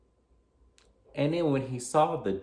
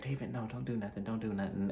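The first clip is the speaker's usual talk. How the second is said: hushed, hurried plea to stop; mock-panicked soft pleading; freaked out; 8 dB quieter, faster than usual. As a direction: beg him quietly not to act, half-acted. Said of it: "[name]'s kinda freaked out".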